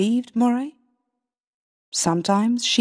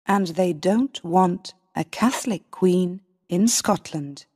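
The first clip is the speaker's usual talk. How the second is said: ordinary delivery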